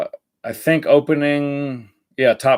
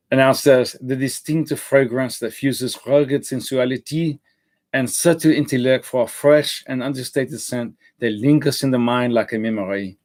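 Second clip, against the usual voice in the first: reading the ad blurb in a mock French accent; playful faux-French accent, like a perfume ad. Reviewer reads the marketing copy aloud, in a mock French accent. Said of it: "I don't know why I went French there".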